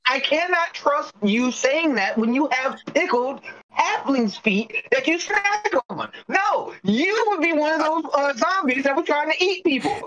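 high-pitched indignant objection; indignant arguing. Acted. Player accusing a fellow adventurer of untrustworthy habits, indignant arguing. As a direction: argue it high and insistent. Heated in-game argument between players.

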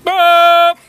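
very high, very loud boop; loud noise. Pretend. Asked to make a loud noise, loud high boop. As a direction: blast out one piercing held boop. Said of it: "Let's hear a loud noise".

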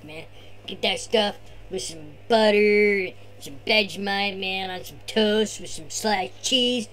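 laid-back surfer bro; be the chill beach surfer dude; Tony surfer voice. Acted. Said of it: "turn this over to Tony".

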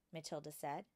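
usual speaking voice

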